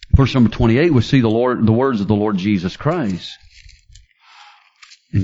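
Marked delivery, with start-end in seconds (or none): none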